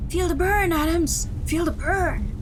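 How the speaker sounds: high, loud drill-style urging; pushy trainer's high shout; training coach. Retold or imitated. Remembered trainer urging on Adams.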